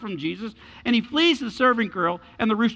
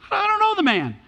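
high-pitched, acted protest; protesting denial; 12 semitones higher than usual. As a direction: deny it in a much higher voice.

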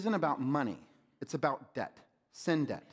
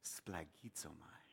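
splagizomai whispered softly and slowly; whispered word; 10 semitones lower, 19 dB quieter, slower than usual. Shown as a demonstration. Preacher leading the room to repeat it, splagizomai whispered slowly. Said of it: "Everybody say splagizomai".

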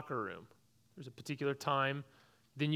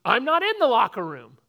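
louder mock protest; retold yelling back; 8 semitones higher, 15 dB louder than usual. A boy yelling back at his gym teacher, loud mock protest. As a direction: shout back your innocence, retold. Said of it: "And I'm saying".

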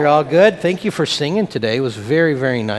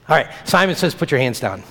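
ordinary delivery

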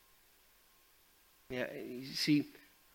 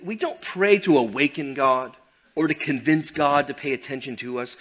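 ordinary talk, nothing marked

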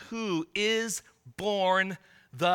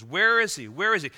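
louder, urgent asking of the wise men; eager wise men; 3 semitones higher, 7 dB louder than usual. Retold or imitated. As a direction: call it out like travellers searching town. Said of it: "It's a repeated question".

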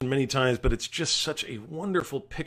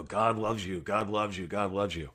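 low, soft, monotone recital; clichéd rote repetition; 4 semitones lower, 6 dB quieter than usual. Shown as a demonstration. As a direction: reel it off like a worn-out cliché. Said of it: "said in such a way that it becomes, you know, cliche".

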